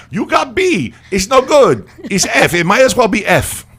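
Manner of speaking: high, loud mock Indian accent; hammed-up Indian parent voice. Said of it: "My Indian accent is horrible".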